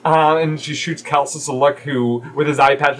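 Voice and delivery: ordinary talk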